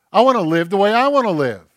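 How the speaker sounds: loud, high wilful outburst; defiant self-will. Acted. Preacher voicing his own sinful nature, loud defiance. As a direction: belt it out like a stubborn rebel.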